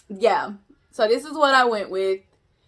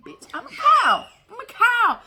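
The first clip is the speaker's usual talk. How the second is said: high, loud squeal; excited shout; 12 semitones higher, 6 dB louder than usual. Shown as a demonstration. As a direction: belt it out in glee. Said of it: "trying the phrase different ways".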